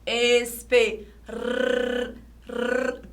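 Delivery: "Espe", pause, then two long rolled R trills; trilled R. Shown as a demonstration. Teacher showing the R trembles, trilled. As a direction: isolate the vibrating R after "Espe".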